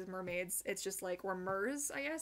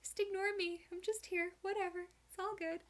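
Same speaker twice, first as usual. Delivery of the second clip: high casual little dog voice; the dog shrugging off being caught in the dryer; voicing the dog; 9 semitones higher than usual. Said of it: "you know, like".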